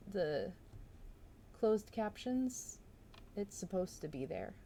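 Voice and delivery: ordinary delivery, nothing marked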